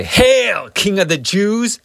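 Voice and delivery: loud, high mock salute; mocking hail. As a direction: jeer it like soldiers saluting a false king. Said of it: "to mock him as a king".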